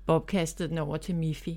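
plain reading voice

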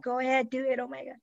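high-pitched gleeful egging on; excited urging. Retold or imitated. Friends egging on the kiss, high excited urging. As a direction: urge them on like thrilled friends. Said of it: "And then they're like".

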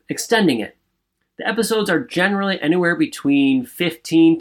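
loud, upbeat promo voice; podcast ad read. Acted. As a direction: sell it like a radio spot.

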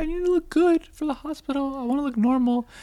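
high-pitched mock wife voice; imitating wife. Husband voices wife's excuse.

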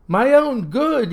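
high, loud, slow indignant shout; angry shout. Acted. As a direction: throw his words back at him. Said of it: "he shouted back".

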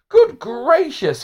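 high, slow, stunned exclamation; drawn out like you just landed on your head; dazed surprise. A narrator's reading. The teacher, just fallen to earth, exclaims in surprise.

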